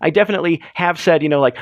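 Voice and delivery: ordinary delivery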